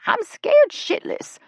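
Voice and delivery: very high, loud, slow squeal; scared. Acted. Ella confessing fear to the group, high squeal. Ella frightened by possible bear.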